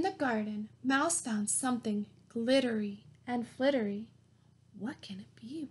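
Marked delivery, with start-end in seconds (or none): 4.8-5.7 hushed, breathless wondering; whisper. A narrator's reading. To the little listeners, a wondering whisper. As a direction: whisper it like a secret. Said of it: "wondered Mouse".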